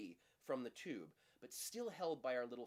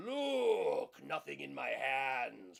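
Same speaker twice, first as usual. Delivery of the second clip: over the top; 2 semitones higher, 10 dB louder, much slower than usual. Retold or imitated. Magician mocking the old empty-hands gesture, hammed-up. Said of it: "which is a bit over the top".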